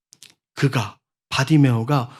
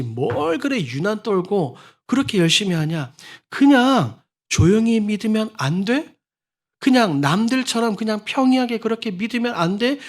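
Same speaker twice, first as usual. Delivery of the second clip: scornful nagging; 8 semitones higher than usual. Retold or imitated. Relatives scoffing at a zealous believer, rising mocking nag. Mocking voices of close people.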